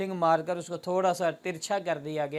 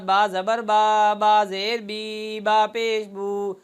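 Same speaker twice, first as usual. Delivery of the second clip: बा ज़बर बा, बा ज़ेर बी, बा पेश बू drawn out very slowly; slow over-lengthened recitation; stretched harakat; 3 dB louder, slower than usual. Shown as a demonstration. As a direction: drag each vowel too long. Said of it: "ये तरीका गलत है".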